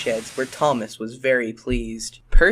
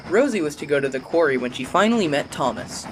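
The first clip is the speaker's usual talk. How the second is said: plain reading voice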